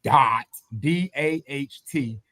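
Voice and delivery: dot, D A H T spelled slowly. Shown as a demonstration. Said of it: "it's phonetically ah".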